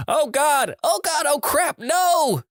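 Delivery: high-pitched panicked screaming, acted out; shrill-pitched play-acted cries of horror; mock scream. A narrator's reading. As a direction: yelp it way up high like a horrified friend. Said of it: "followed by the friends screaming".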